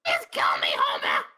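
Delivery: low whispered Marge plea; Marge Simpson voice. Acted. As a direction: beg Homer in a harsh whisper. Marge begging Homer.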